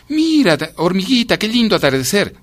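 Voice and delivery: high, lilting character voice; friendly passer-by. A narrator's reading. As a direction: coax the little ant in a high voice. Passer-by admiring sunset to ant.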